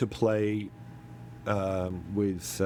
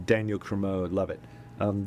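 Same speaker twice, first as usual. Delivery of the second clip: ordinary talk, nothing marked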